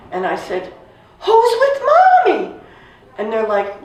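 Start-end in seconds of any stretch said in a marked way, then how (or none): 1.2-2.5 very high, loud pleading cry; child's desperate cry. Retold or imitated. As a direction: cry out like a frightened little girl. Child demanding news of mother.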